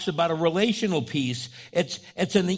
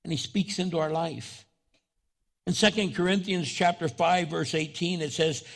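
normal talking voice

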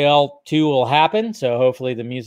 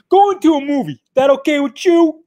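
high, loud mock Raphael voice; Raphael impression; 12 semitones higher, 6 dB louder than usual. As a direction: throw on a tough turtle's put-on voice. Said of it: "trying to do the impression".

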